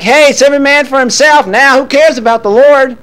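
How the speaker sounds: David brushing off the Lord, flippant and loud; careless mock David. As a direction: toss it off like nothing matters. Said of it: "it's like".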